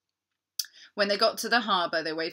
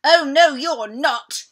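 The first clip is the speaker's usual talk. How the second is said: loud, high shout of defiance; defiant yell; 8 semitones higher, 13 dB louder, slower than usual. Acted. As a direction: fire it back at the dinosaur. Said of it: "yelled Captain Flynn".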